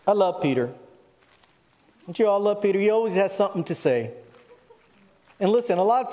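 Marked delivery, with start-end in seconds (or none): none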